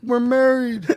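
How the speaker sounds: high-pitched, whiny fake bawl; hammed-up tearful whine; mock crying. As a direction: wail it like a crybaby making excuses. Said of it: "they start crying".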